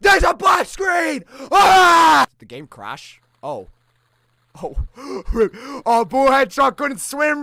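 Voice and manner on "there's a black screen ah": panicked yells at a sudden scare; scared screaming. Real, not acted. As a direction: shriek in real fright.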